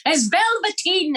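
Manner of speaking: high, slow prissy voice; funny voiceover. Retold or imitated. Dubbing an old film for fun, slow high prissy voice. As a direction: correct them slowly in a high prim voice. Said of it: "voice over them".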